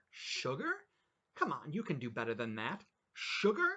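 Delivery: mocking ranting. Customer mocking the waitress, incredulous and loud. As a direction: sneer her own word back at her.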